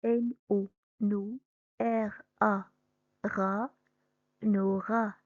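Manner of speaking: teacher blending syllables; sounding out a word. Shown as a demonstration. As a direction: split nani into na and ni, then blend. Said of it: "La même chose".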